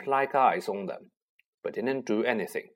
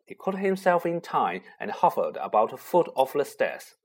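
ordinary delivery, nothing marked

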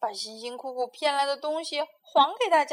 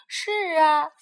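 pained cry; 5 semitones higher, 10 dB louder than usual. Acted. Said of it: "花袜子痛苦地叫道".

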